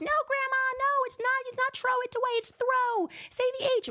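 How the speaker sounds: put-on high childlike voice, insistent; child correcting grandma. Little tot correcting her Caribbean grandmother, high childish voice. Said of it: "very thick Caribbean accent".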